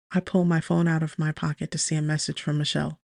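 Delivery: plain reading voice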